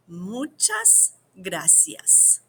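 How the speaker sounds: Muchas gracias drawn out slowly; slow teaching demo. Shown as a demonstration. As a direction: model each syllable for the class. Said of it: "this will take some practice".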